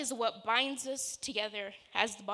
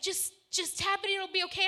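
high, lightly mocking brother voice; imitating brother; 9 semitones higher, 6 dB louder than usual. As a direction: parrot the brother's easy advice up high. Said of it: "And my brother's like".

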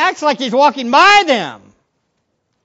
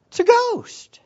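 mock scream; 14 semitones higher than usual. Disciples spotting Jesus on the water, mock screaming. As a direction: echo the scream at normal volume. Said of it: "They scream".

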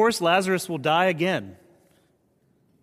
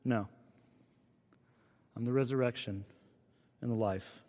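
softer, hushed reply; gentle whisper; 3 semitones lower, 6 dB quieter than usual. Acted. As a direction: breathe it tenderly like a whisper. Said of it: "And he whispers".

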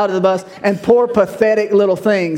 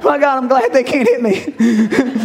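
higher, giggling with relief; half-laughing quote; 5 semitones higher than usual. Retold or imitated. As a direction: laugh through it, relieved recruit. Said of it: "so I remember being there like".

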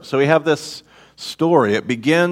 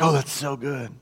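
delighted sigh; 10 dB quieter than usual. Retold or imitated. God delighted, voiced softly.

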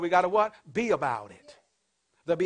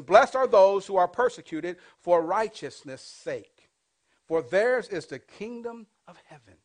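slow, louder, weighty reading of the verse; solemn scripture reading; 5 dB louder than usual. Acted. Pastor reading the Beatitude to the congregation, slow and solemn. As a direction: lay each word of the Beatitude down slowly.

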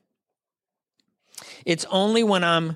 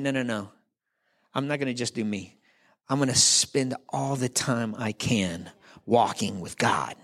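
lower, softer, understated refusal; lightly voiced Noah; 6 semitones lower, 3 dB quieter than usual. Noah answering a self-serving culture, quiet low resolve. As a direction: quietly hold your ground, dropping lower to the end. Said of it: "Noah's the one guy that's saying".

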